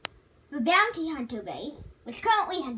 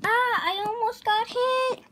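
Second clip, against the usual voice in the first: higher, louder near-miss shout; startled excitement; 7 semitones higher, 8 dB louder than usual. Pretend. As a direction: yelp after almost being hit. Nearly hit in play.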